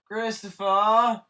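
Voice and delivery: high, loud, drawn-out name call; singsong shout across the house. Real, not acted. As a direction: holler for a brother out of sight. Calls brother in another room.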